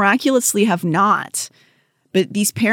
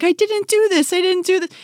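put-on crying kid; 13 semitones higher than usual. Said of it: "he's like".

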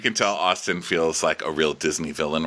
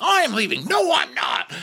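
high mock-dramatic exit; Austin. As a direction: squawk it out, then reverse just as dramatically. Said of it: "You know, he's like".

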